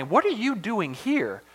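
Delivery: slow, high swoops on "you" and "here"; stress on YOU and HERE. Shown as a demonstration. God questioning Elijah near Mount Sinai, stress on "you" and "here". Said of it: "a big emphasis on you".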